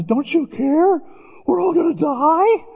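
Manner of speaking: high, frantic cry of men in a storm; panicked disciples. Retold or imitated.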